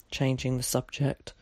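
ordinary delivery

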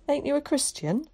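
put-on high, startled boy's question; alarmed, high-pitched disbelief. A narrator's reading. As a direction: ask it in alarm, voice shooting up. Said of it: "asked Willie in alarm".

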